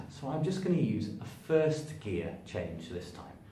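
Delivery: ordinary talk, nothing marked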